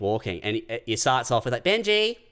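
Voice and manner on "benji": calling a dog. Retold or imitated. Retold owner calling her dog.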